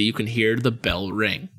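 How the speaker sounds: ordinary talk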